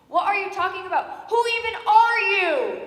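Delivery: loud, high angry demand. Acted. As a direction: snap at the intruder. Angry confrontation of stranger.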